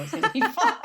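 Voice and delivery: quoting the doctor, breaking into laughter; high, loud and laughing on the last words; laughing through it. Retold or imitated. Retelling her obstetrician's promise, laughing.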